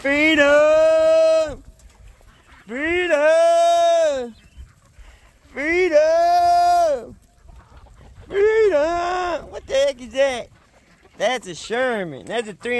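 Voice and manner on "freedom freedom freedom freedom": loud, high rallying cry of freedom; joyful shout. Real, not acted. Farmer shouting to his released birds, loud triumphant cry. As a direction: bellow it out as the gate opens.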